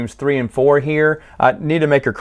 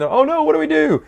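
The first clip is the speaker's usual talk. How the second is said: high, frantic cry of mock panic; panicked townsfolk. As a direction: wail it like a helpless crowd in a cartoon. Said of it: "The town's crying".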